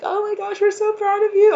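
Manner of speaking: high squeal, choked with emotion; tearfully proud. Acted. Spirit team tells listener they're so proud, tearful high gush. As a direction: gush with pride, near tears. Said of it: "I might actually cry".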